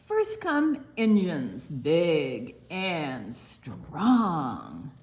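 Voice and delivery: slow, stretched storybook reading; reading to children. Acted. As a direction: lean into each line for the kids. Reads picture book aloud.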